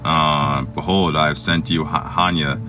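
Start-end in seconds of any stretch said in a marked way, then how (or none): none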